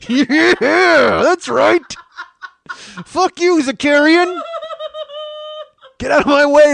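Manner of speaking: very high, loud gloating; hammed-up victory yells; triumphant shouting. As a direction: crow over him, shouting in triumph. Gloating after the comeback.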